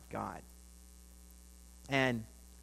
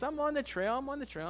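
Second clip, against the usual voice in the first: much higher imitated voice; mimicked protest; 10 semitones higher than usual. Kim answers the worried man from the trail, mimicked high-pitched protest. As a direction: pitch it up, imitating a companion's insistence. Retold companion's reply, pitched up.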